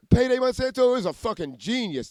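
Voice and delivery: high, odd mock praise; mock voice of the voices. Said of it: "they would say".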